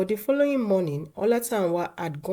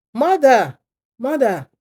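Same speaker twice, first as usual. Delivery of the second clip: loud high call across the house; 8 semitones higher, 11 dB louder, slower than usual. A narrator's reading. Son calls for his absent mother, loud and high. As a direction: shout for her through the house. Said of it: "called Adegoroye".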